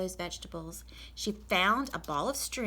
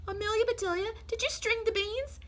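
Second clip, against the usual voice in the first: bossy lady of the house, much higher and brisk; Mrs Rogers. Acted. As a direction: read out the chore list as the fussy employer.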